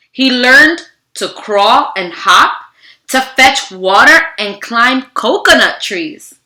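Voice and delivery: steady storybook narration, slowing on key moments; reading to children. Acted. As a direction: read the story aloud to a class. Reads picture book to children.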